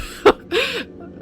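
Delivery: high burst of laughter. Real, not acted. Laughs after flubbed line.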